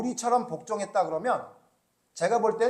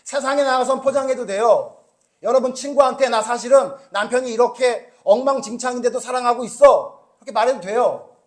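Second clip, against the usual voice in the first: loud, driving rebuke from the pulpit; preaching at full force; 6 semitones higher, 12 dB louder than usual. Acted. Preacher rebuking his congregation, loud and forceful. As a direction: hammer the point home to the pews.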